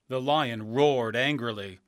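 usual speaking voice

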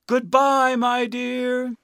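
high, loud, drawn-out farewell; calling out. A narrator's reading. Balloonist floating away from Dorothy, calling out. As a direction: call it across a growing distance.

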